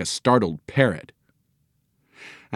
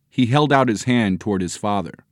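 usual speaking voice

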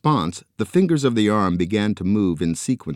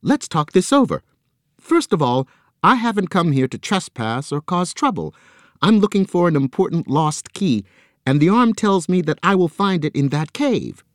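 high, louder hurried reasoning; urgent pleading; 10 semitones higher, 4 dB louder than usual. A narrator's reading. Joe reasoning with the squid, high and urgent. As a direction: talk fast to calm a threat.

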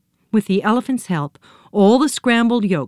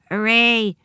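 tiny voice; 4 semitones higher than usual. A narrator's reading. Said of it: "said a tiny voice".

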